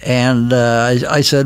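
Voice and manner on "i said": ordinary talk, nothing marked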